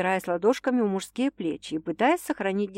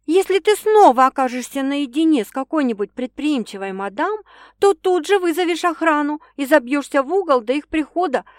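put-on high, louder young woman giving orders; bossy girlfriend's rules; 7 semitones higher, 7 dB louder than usual. A narrator's reading. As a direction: lay down the law playfully. Sasha laying down rules.

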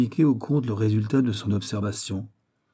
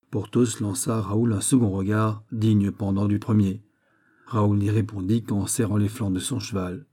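plain reading voice

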